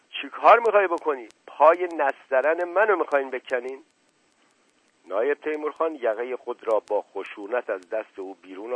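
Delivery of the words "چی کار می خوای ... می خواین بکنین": high, loud outraged questioning; furious shout. Acted. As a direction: cry out in protective fury. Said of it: "از فرط خشم کف بر لب آورده بود، یقهٔ مفتش را گرفت و فریاد زد". (فریاد از سر خشم)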